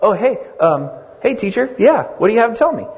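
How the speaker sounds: a caught-out Pharisee, high and fast at the end; startled. Retold or imitated. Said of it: "all of a sudden he's like".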